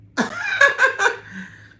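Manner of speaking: loud shrieking laugh; real laugh at the imagined pause; high squealing laugh. Real, not acted.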